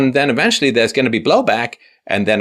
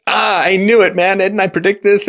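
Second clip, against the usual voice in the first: much higher, louder mock told-you-so; vindicated whiner; 11 semitones higher, 5 dB louder than usual. Dumped boyfriend gloating he predicted it, mock. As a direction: crow in a put-on voice. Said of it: "he's like".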